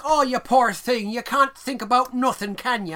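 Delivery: high, loud, drawn-out hammed-up pity; pitying neighbor lady. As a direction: play a condescending Irish neighbor, slowly and loudly. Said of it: "that neighbor lady's like".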